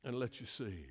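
ordinary talk, nothing marked

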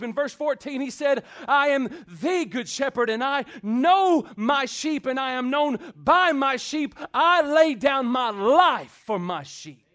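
loud, high, rousing sermon delivery; full-force preaching. Acted. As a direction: preach it out to the whole church, building.

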